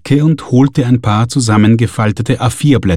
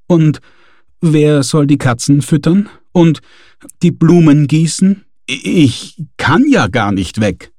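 higher, hurried fretting; flustered objection; 6 semitones higher than usual. A narrator's reading. Gasperlmeier panicking about cats and flowers. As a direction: list excuses in a fluster.

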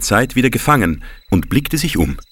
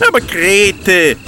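calling out; 11 semitones higher, slower than usual. Acted. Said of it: "rief der Wurstel".

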